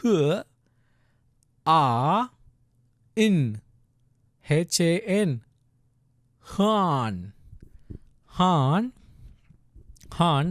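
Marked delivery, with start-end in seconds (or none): 0.0-3.6 "h", "a", "in" each held alone; sounding out letters. Shown as a demonstration. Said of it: "the first syllable is". 6.6-10.5 "Han" drawn out with a long vowel; syllable demo. Shown as a demonstration. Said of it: "that's the syllable".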